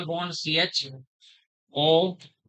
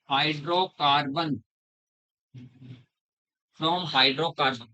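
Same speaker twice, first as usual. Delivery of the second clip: very slow, each word drawn out; slow dictation; much slower than usual. Shown as a demonstration. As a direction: dictate "from hydrocarbon" slowly for notes. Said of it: "teacher dictates heading slowly". (धीरे-धीरे लिखवाते हुए)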